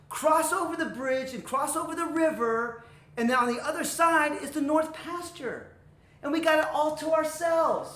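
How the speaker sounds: big brother taking charge, drawing out the words; confident middle brother. Acted. Middle goat telling his brothers the plan, confidently.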